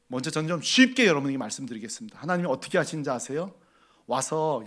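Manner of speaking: ordinary talk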